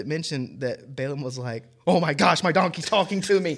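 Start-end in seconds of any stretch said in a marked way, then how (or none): 1.9-3.6 higher, louder hammed-up shock; mock panic. Balaam gasping that his donkey talks, hammed-up and loud. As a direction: overplay a man stunned by his donkey.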